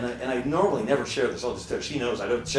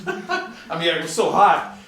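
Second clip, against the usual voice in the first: laughs through it, louder; laughing; 6 dB louder, slower than usual. Real, not acted. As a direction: say it while laughing at the memory. Laughing at his burnt house.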